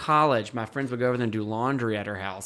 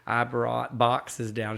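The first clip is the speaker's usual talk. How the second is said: normal talking voice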